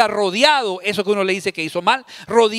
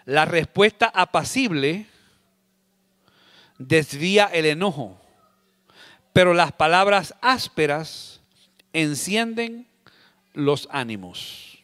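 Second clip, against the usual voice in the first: slow, softening reading of the proverb; slow scripture reading; 3 semitones lower, slower than usual. Acted. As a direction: read it gently, letting it settle. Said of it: "Proverbios quince uno".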